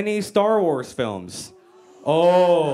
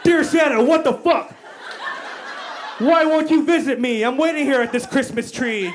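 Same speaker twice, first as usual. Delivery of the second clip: [name] shouting at [name]; 9 semitones higher than usual. Retold or imitated. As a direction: rant at Santa like an obsessed fan. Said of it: "like [name] writing to [name]".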